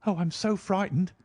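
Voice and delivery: breathless high fright; panting, frightened. A narrator's reading. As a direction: gasp it out, scared. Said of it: "I panted".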